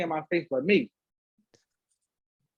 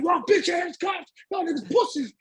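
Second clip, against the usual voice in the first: mock yell; 14 semitones higher, slower than usual. Young boys yelling from cells.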